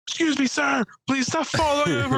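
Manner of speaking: very high, prim fake-polite voice; sing-song high mock courtesy; mock polite rebuke. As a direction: scold him like a prissy hostess.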